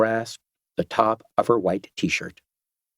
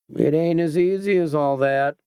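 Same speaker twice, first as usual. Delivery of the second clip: thoughtfully; 6 semitones higher than usual. A narrator's reading. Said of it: "he said thoughtfully".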